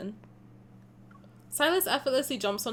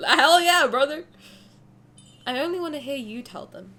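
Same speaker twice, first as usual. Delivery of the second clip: normal talking voice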